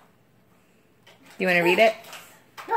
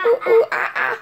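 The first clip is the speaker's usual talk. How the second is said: high, loud laughing monkey call; monkey noise; 14 semitones higher, 4 dB louder, faster than usual. Shown as a demonstration. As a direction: hoot like a monkey to make the baby laugh. Answering what a monkey says.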